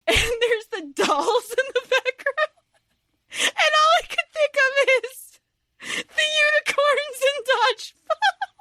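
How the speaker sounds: very high, laughing squeal; helpless high-pitched giggling delivery; cracking up. Real, not acted. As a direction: squeak it out through real laughter. Cracking up over the dolls.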